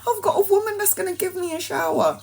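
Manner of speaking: very high, fast squeal of joy; overjoyed. Retold or imitated. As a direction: squeal it out in relief. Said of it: "She's like".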